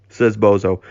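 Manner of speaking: ordinary delivery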